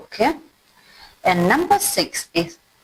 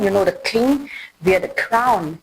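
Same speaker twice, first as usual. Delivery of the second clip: ordinary talk, nothing marked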